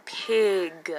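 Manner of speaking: "Pig" slowly, every sound distinct; short I sound. Shown as a demonstration. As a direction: stretch out "Pig" with an ih vowel and released g. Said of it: "is the short I".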